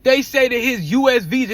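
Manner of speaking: ordinary talk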